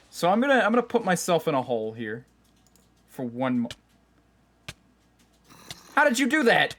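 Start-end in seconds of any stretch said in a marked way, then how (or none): 5.9-6.7 startled. Real, not acted. Mob sneaks up in game.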